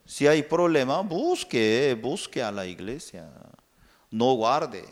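usual speaking voice

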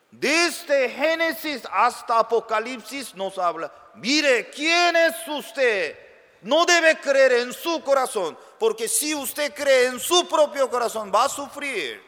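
high, loud fervent preaching; preaching at full force. Acted. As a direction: thunder it from the pulpit. Predicador alzando la voz. (predicando con fuerza)

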